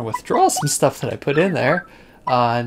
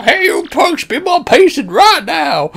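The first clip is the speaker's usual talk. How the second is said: put-on higher, louder grumpy old-man yell; mock yell; 13 semitones higher, 6 dB louder, slower than usual. Old Koopa imagining scolding impatient kids, mock yell. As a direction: bark at the punks like a cranky geezer. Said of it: "I want to stand up and yell".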